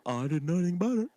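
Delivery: mock-innocent Southern Indiana voice. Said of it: "that's my Southern Indiana voice for you".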